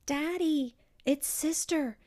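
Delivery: blurting bad news to Daddy; alarmed child. A narrator's reading. Child reporting arrest.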